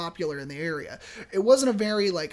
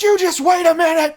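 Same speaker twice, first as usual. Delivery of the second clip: much higher and louder curt retort; snapping back; 9 semitones higher, 11 dB louder than usual. Retold or imitated. Arguing couple snaps at the desk clerk, loud and high. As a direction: bark it at the clerk. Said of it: "And they're like".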